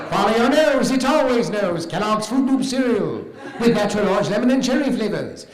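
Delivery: high, loud cartoon toucan voice; Toucan Sam. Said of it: "it's a voice I do in a commercial".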